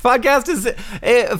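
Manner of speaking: laughing as he talks; words through laughter. Real, not acted. Laughing after partner's joke.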